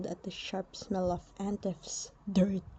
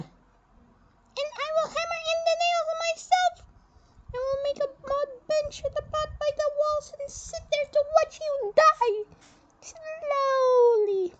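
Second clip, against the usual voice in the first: a much higher, firm general announcing the burial; stern general voice. Acted. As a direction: speak as a proud Egyptian general, high and set. General threatens cruelly.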